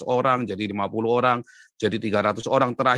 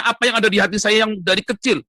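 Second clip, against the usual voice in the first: high-pitched, raised-voice outpouring; crying out; 9 semitones higher, 4 dB louder than usual. Real, not acted. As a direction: pour it out in a raised, excited pitch. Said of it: "teriak sekeras-kerasnya". (berseru dengan suara tinggi)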